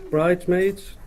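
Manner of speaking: ordinary delivery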